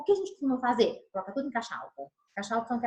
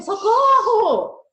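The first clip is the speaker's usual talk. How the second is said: much higher, much louder, very slow panicked shout; real cry for help; 11 semitones higher, 10 dB louder, much slower than usual. Shown as a demonstration. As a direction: scream "Socorro!" like you mean it. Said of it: "Um eu tava pedindo socorro mesmo".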